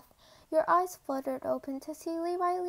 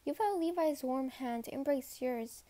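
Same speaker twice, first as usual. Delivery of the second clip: plain reading voice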